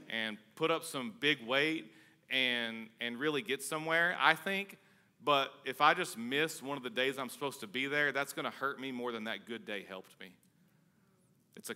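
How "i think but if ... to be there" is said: usual speaking voice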